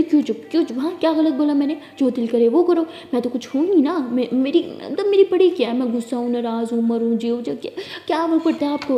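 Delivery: loud, hurt accusing rant; angry rant. Acted. Sulking girlfriend loudly accusing her partner of not caring.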